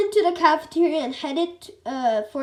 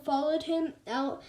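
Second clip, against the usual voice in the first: ordinary delivery, nothing marked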